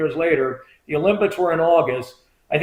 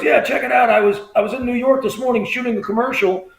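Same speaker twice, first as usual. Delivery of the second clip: higher, eager phone voice; imitated excited friend; 6 semitones higher than usual. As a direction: play a buddy bursting with news. Friend's breathless news, retold.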